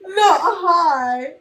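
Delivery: high squeal through laughter; loud, real cracking up; helpless laughter. Real, not acted. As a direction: wail it while laughing. Cracks up at the punchline.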